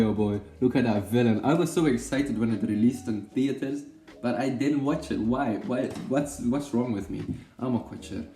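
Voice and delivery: ordinary delivery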